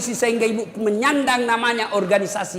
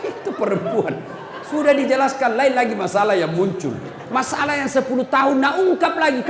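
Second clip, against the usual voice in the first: ordinary talk, nothing marked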